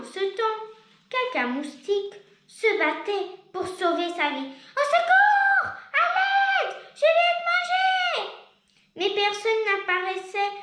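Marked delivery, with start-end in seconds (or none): 4.8-8.3 high, loud panicked cries; yelling for help. Acted. Caca Moustique surrounded by polar bears, yelling for help. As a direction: scream for help as bears close in. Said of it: "hurle Caca Moustique".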